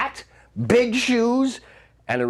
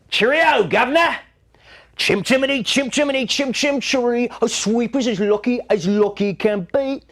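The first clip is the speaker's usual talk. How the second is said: cockney chimney sweep; 6 semitones higher than usual. Acted. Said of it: "Chimney sweeps".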